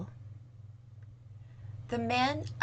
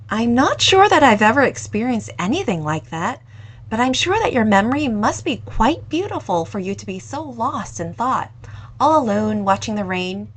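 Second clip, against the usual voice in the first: louder, bright-eyed curious voice rising at the end; young owner eagerly asking for his story, louder; eager young cafe owner; 2 semitones higher, 7 dB louder than usual. Acted. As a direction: coax the story out of him, eagerly.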